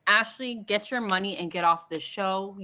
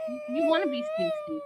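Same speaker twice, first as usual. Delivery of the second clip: mock whine. Mocking husband, high cry.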